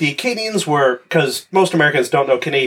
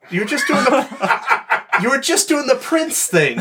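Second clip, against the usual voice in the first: laughing; 12 semitones higher than usual. Real, not acted. Laughs at the ruffle jeans.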